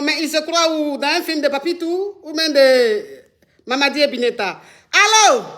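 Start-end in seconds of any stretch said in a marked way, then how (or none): 4.9-5.4 loud, high hello into the phone; calling out hello. Real, not acted. Live host calling into a dead line, loud hello. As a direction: call out to check the line is alive.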